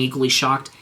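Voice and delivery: ordinary delivery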